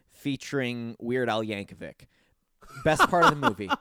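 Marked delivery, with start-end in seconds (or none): 2.8-3.7 loud, high delighted praise; gushing. Real, not acted. Podcaster raving to Jeff about the talk show scene, loud and delighted. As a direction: rave about it.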